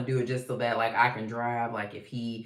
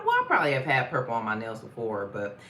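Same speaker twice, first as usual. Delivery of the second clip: ordinary delivery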